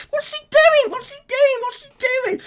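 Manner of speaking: very high, squeaky cartoon voice; squeaky mock voice. Reacting to a viewer's name in a squeaky cartoon voice.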